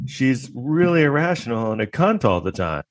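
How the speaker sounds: ordinary delivery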